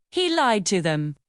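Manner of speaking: usual speaking voice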